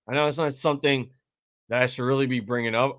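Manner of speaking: normal talking voice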